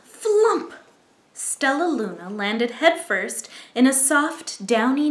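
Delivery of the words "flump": high, loud comic thud; flump sound effect. A narrator's reading. Bat lands in nest.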